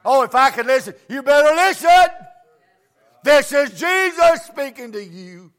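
loud, slow preacher's call to the crowd; loud preaching call. Acted. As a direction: boom it out slowly like a revivalist.

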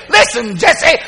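loud imitated call; father's insistent holler; calling out to wife. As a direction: call to your wife from the next room. Acts father calling to mother.

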